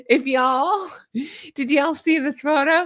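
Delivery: amused slip into a Southern twang; slipped Southern accent. Real, not acted. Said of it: "I just slipped Southern".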